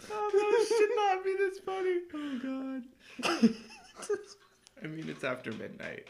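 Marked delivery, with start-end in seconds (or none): 0.1-3.5 high, laughing through the words; amused disbelief, cracking up. Real, not acted. Friend reacts to the joke, laughing.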